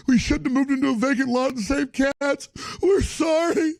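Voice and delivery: high, whiny, pleading mock voice; mock groveling liberal women. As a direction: grovel and whimper like apologetic activists. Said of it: "liberal women going".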